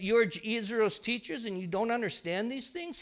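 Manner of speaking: soft, even and gentle rebuke; calm, nice. Acted. Preacher voicing Jesus answering the teachers, calm and kind. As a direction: say it softly, without any sting. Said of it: "calmly when he says".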